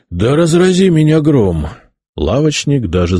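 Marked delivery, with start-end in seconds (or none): 0.1-1.7 loud astonished oath; shocked exclamation. A narrator's reading. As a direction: exclaim in disbelief.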